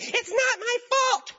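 high, slow whiny "it's not my fault"; high mocking woman's protest; indignant woman. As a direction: squeal like an outraged driver. Woman fighting with the judge.

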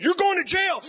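loud mock bellow; hammed-up angry judge; judge's shout. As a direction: bark the sentence at her.

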